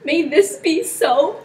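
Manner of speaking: slow, high, shaken question; barely daring to ask; shaken doubt. Acted. Claudio asking if Hero is false, shaken.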